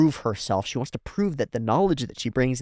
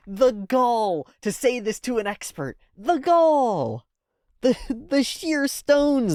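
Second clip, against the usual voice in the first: much higher, slow scandalised exclaim; mock outrage; 11 semitones higher, slower than usual. Real, not acted. Reactor to Marcel, hammed-up scandalised outrage. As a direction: savour the outrage like a gossip.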